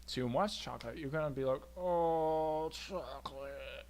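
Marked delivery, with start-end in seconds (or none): 1.8-3.9 exaggerated, sagging moan; mock overindulged groan. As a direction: drag the word out as if overstuffed. Acted overindulged chocolate eater.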